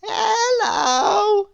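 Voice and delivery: very high, slow sing-song voice; cheerfully refuse, very high; contrary Miss Contrary. Acted. Miss Contrary answers Mister Small, very high sing-song. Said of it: "said little Miss Contrary".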